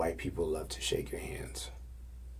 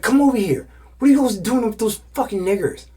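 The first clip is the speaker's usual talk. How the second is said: high, loud bullying yell; imitated angry demand; 12 dB louder than usual. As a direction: scold her loudly, acting him out. Man demanding she come, acted quarrel.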